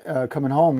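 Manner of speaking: usual speaking voice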